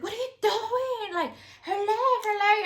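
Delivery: high alarmed cry; mock panicked shout over her leg; imitating panic. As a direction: act out a frantic onlooker. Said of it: "she was like".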